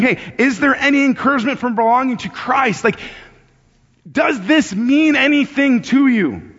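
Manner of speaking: high, urgent pleading questions; impassioned appeal. Acted. Paul appealing to the Philippians to think differently, impassioned. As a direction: press them, pitch lifted, to stir emotion. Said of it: "trying to elicit some emotion".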